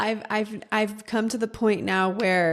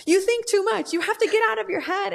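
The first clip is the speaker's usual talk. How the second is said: Mickey Jacobs insisting; 12 semitones higher than usual. Retold or imitated. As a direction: repeat it much higher, an insistent mentor's voice. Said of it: "she was like".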